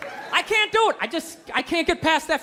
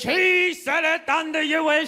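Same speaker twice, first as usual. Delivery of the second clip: mock priest; slower than usual. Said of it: "speaking in Old English".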